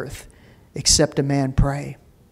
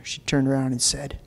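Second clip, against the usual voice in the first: usual speaking voice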